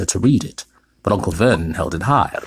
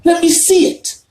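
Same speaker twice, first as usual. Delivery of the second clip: much higher, louder demand; 7 dB louder than usual. A narrator's reading. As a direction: spoilt boy insists. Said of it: "demanded Dudley".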